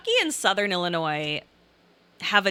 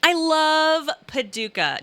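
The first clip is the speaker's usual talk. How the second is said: fond exclamation; 7 semitones higher, slower than usual. Real, not acted. Fond declaration.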